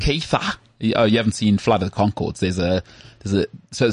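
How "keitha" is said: high mock-Australian name call; Australian accent. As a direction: put on an Australian twang, pitched up. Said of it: "a one-nighter with an Australian".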